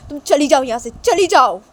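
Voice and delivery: loud furious father ordering her out; angry father. Acted. Father angrily sending daughter out of house, loud shout.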